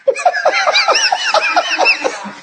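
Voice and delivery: very high, wheezy run of laughter. Real, not acted. Preacher after saying he has too many answers, high laughter. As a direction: break into a long, squealing laugh.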